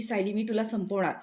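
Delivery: ordinary delivery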